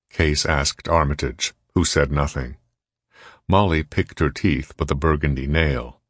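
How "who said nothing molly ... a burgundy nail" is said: usual speaking voice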